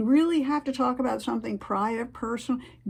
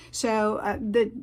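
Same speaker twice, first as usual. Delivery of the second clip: ordinary talk, nothing marked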